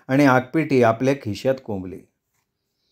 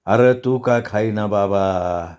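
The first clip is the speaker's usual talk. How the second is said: slow, low coaxing; low, drawn-out elder's plea; 4 semitones lower, slower than usual. A narrator's reading. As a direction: draw it out low, urging gently. Elder coaxing boy to eat.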